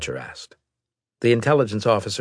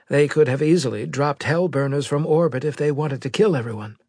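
higher-pitched psychologist's voice; 9 semitones higher than usual. Acted. Psychologist giving the admiral an opinion, higher-pitched voice. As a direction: reason it out in a raised pitch.

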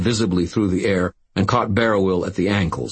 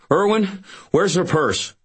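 shouting; 14 semitones higher than usual. A narrator's reading. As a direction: demand it at the top of your voice. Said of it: "I shouted".

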